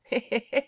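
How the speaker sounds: loud high mocking he-he-he; street boys' cackle. A narrator's reading. Street boys jeering at the stranger, loud mocking laugh. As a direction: cackle like cheeky street urchins. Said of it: "lachten zur gleichen Zeit ein paar teilnehmende Straßenjungen".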